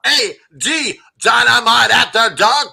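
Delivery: drawn-out announcer tag. Acted. Drawn-out show title tag.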